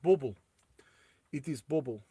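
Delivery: lightly put-on Russian accent; playful mock Russian accent. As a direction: say "Bauble" like a Russian, with a short "boo" vowel. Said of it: "I need to say it in a Russian accent".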